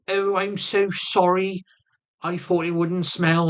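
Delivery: high, slow mock apology; imitating his partner. Partner apologising for farting in the car, imitated sheepish voice. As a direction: drag out her sheepish sorry. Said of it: "And she goes".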